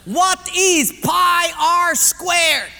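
loud, high and very slow; slow quizmaster's shout; declaimed quiz question. Acted. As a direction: announce it grandly, word by word.

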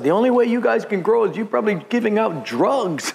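a hint of a fault-finding rival; critical pastor, lightly voiced. Rival pastor accusing the growing church, mock accusing.

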